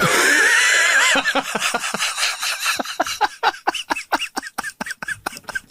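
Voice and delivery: long high-pitched laughter. Real, not acted. Laughing at punchline.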